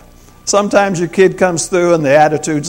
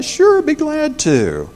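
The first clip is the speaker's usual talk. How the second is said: cheerful willing reply; 13 semitones higher, slower than usual. Pretend. Husband's kind reply to wife.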